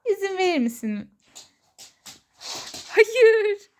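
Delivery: high, loud playful no; talking to a dog. Real, not acted. Playing tug with her pet. (köpekle oynarken tiz sesle)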